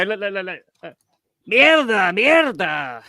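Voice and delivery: cartoonish Mexican mierda; Speedy Gonzalez accent. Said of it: "I'm doing a Speedy Gonzalez accent".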